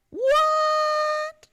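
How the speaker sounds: high, loud squealed disbelief; hammed-up shriek of astonishment; mock shock. Acts out shocked reaction to reveal.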